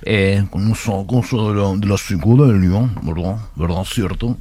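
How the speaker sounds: a hint of a slurred singer impression. Host teasing guest about imitating singers, lightly mumbled impression. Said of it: "poquito de [name] o encalamararte un poco".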